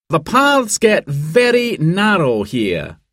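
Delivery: high, slow briefing voice; mountain guide explaining. A narrator's reading. Guide Dan points out hazards.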